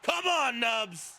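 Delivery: high, rousing cheer that falls away; calling out. Real, not acted. Commentator urging on the wrestlers, calling out. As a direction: cheer your team on from the commentary desk.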